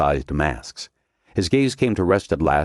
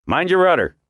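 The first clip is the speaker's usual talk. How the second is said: much higher, louder call; called order; 13 semitones higher, 4 dB louder than usual. A narrator's reading. Commander calls to the helmsman, loud. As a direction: shout it across the boat.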